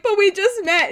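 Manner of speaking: high-pitched imitation of tearful protest; mock sobbing.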